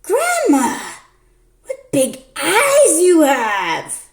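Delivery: put-on a wide-eyed child, drawn out; little girl voice.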